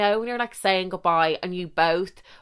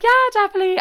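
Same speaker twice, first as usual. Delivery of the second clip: squeaky eager yes; 14 semitones higher, 4 dB louder, much faster than usual. Retold or imitated. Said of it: "so I was like".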